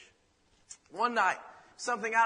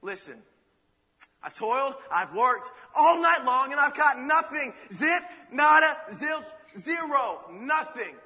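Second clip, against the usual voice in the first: weary protest; 5 semitones higher, 7 dB louder, slower than usual. Acted. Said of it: "He says".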